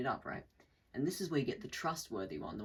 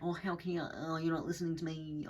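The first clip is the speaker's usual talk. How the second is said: annoying narcissist; 4 semitones higher than usual. Acted. Narcissist complaining of not being heard, annoying mock whine. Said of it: "they kinda sound fucking annoying".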